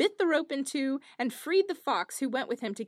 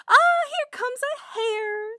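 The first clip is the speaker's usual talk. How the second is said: bored minstrel spotting hare; 12 semitones higher, 8 dB louder, slower than usual. Acted. Minstrel voiced in fairy tale.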